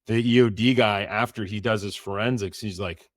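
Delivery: normal talking voice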